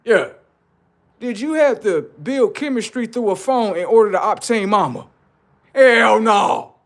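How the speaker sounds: put-on high, loud old man answering; old granddaddy. Comedian asks granddaddy about phone dating, loud old man. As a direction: play grandpa barking back.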